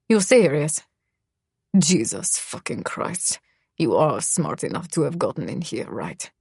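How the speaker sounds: Misha, low and cold, almost murmured; dropped low, a menacing murmur; low male voice. Acted. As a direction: voice the brooding boy low and quiet.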